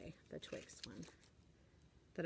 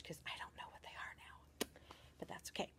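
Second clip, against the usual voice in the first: whisper; 3 semitones lower, 14 dB quieter than usual. Real, not acted. Aside to camera about gifts.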